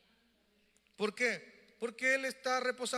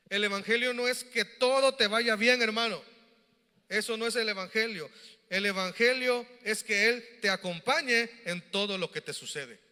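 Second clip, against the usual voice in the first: louder, ringing preacher's call; preaching; 6 dB louder than usual. Acted. Pastor pressing the gospel on his church, loud preaching. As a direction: drive it home to the congregation.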